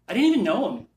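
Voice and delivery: loud, high-pitched outburst of resentment; indignant. Real, not acted. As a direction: protest it, voice rising sharply. Recalling how he hated Irwin.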